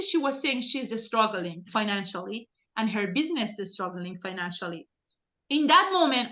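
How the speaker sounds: normal talking voice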